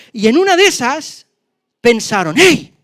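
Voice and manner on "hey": high, loud sudden exclamation; sudden realization. Acted. Lepers suddenly catching themselves while feasting, loud high exclamation. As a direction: snap to attention with a cry.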